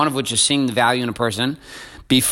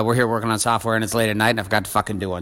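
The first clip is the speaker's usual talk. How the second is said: ordinary delivery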